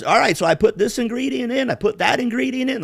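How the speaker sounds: high mock voice; chirpy cooking-show impression; imitating the vlogger. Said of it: "And then he goes".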